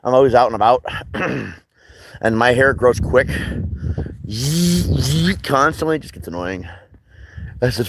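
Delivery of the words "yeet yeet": high zipping buzz; imitated clipper buzz. Imitating clippers while telling of buzzing hair.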